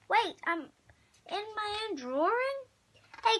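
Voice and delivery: ordinary delivery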